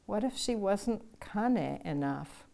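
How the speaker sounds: ordinary delivery, nothing marked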